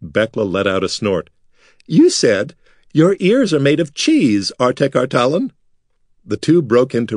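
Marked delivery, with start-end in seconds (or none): 1.9-5.5 mocking through laughter. A narrator's reading.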